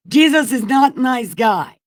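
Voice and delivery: high, loud shouted verdict; outburst. Acted. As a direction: blurt it out as an exasperated shout. Outburst after fig tree story.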